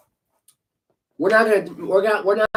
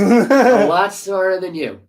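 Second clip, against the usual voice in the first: louder and slow, every word hammered; emphatic repeat; 3 semitones higher, 6 dB louder, much slower than usual. Real, not acted. Host taunting Colin, slow loud emphasis. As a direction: drive it home word by word.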